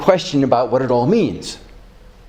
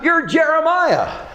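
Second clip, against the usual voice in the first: eager call; 12 semitones higher, slower than usual. Retold or imitated. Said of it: "Philip cries out".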